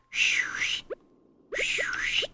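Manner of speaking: breathy unvoiced whistle sound; imitated whistle noise. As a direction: hiss like a party whistle. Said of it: "someone whistling and going like".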